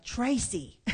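sister's Southern drawl on the name; southern accent. Retold or imitated. Sister calls her by name over the phone, southern accent. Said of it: "That's Tracy in her southern accent".

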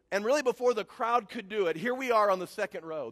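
ordinary talk, nothing marked

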